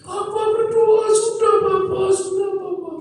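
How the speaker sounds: Miracle. Retold or imitated. Three-year-old pleading with papa to pray, crying. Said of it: "anak tiga tahun ini miracle".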